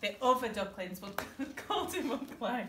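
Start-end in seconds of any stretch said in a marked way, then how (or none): none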